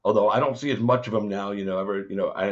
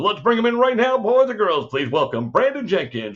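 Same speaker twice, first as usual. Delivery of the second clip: much higher, louder guest intro; hype announcer; 11 semitones higher, 5 dB louder than usual. Real, not acted. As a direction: announce him like a ring entrance. Host hypes guest entrance.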